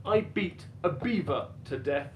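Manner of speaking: ordinary talk, nothing marked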